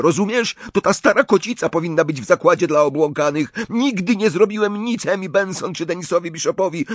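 high, loud, rapid-fire angry outburst; furious boy. A narrator's reading. Riddle leaps away from Dumbledore, refusing the asylum, furious. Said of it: "pieniąc się ze złości".

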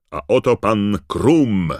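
loud, high, drawn-out announcer's introduction; ring-announcer call, stretching the name; Bagman shouting. A narrator's reading. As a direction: present the contestant to a roaring crowd. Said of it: "krzyknął Bagman".

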